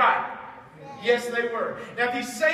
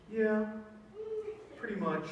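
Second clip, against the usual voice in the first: offhand, quieter, unbothered; casual shrug; 8 dB quieter than usual. Acted. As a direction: shrug it off like no big deal. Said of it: "And he was like".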